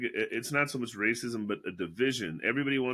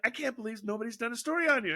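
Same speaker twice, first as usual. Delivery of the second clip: fast gushing mock praise; 9 semitones higher, faster than usual. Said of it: "And I was like".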